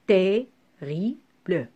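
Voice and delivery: "terrible" split slowly into syllables, pause between each; very slow, chopped-up "terrible". Shown as a demonstration. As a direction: break TERRIBLE into separate syllables. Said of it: "couper le mot par syllabe".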